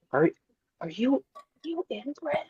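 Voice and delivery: hushed whisper of the forbidden word. Retold or imitated. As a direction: whisper it like a slur. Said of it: "I had to say it real quiet".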